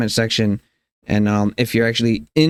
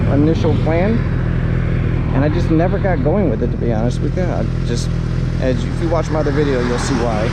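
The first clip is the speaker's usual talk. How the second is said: muffled through helmet mic; talking into helmet mic while riding; inside a helmet; 2 semitones higher than usual. Real, not acted. Moto vlog recorded inside helmet.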